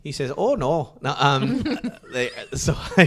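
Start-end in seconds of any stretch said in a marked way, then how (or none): 0.4-1.0 higher, louder Canadian "oh no"; mock Canadian. Imitating the Canadian dude, mock Canadian accent. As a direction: put on a joking Canadian accent. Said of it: "is that how they talk".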